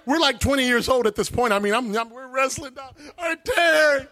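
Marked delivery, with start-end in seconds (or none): 3.2-4.1 higher, eager calling of Terry; excited retold shouts. Telling Terry he got the Holy Ghost, excited. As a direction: cheer Terry on.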